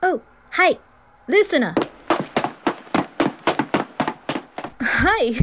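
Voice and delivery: high, loud, cheery hello; eager hey and hi; bright greeting. Acted.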